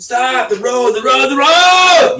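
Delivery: high, loud panicked scream of a passenger. Retold or imitated. Passenger screaming at the driver as the car goes off, panicked shout. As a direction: shriek it like you're watching the car leave the road. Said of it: "when you said".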